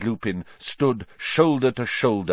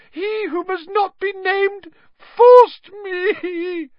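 very high, wailing, tearful plea; squealing, terrified blubbering; sobbing; 5 dB louder than usual. A narrator's reading. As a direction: wail excuses through tears. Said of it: "burst into tears".

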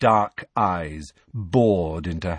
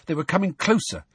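plain reading voice

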